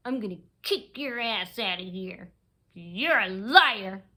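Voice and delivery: slow, put-on voice of an accuser; threatening woman. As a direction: imitate her threat, dragging out the accusation.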